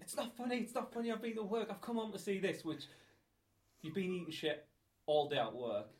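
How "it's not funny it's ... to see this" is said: lightly imitated indignation, much higher; mock indignant girlfriend. Girlfriend scolding the laughing speaker, mock indignant, higher. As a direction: play her telling you off, pitched up. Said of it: "She's like".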